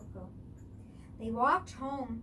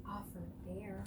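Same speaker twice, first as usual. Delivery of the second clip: ordinary delivery, nothing marked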